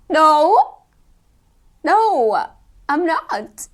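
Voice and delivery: high, loud, tearful mock wail. Actor inwardly wailing at the casting director's question, mock crying.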